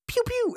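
very high, squeaky blaster sounds; high-pitched mock laser fire; laser pew noises. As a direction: make tiny toy gun noises, very high. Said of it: "he's like".

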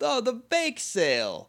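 high, drawn-out group exclamation; mock yell. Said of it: "and they yell".

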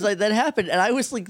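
ordinary delivery

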